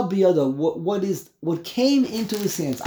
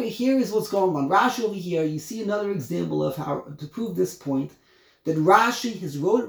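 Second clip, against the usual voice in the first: ordinary delivery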